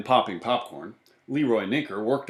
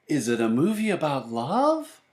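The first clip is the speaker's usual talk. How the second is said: put-on child character's voice; 5 semitones higher than usual. A narrator's reading. Baby asks about the movie.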